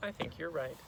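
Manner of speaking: quiet, gentle teacher; hushed, understated reply; soft agreement. Acted. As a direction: agree softly, with the sternness gone.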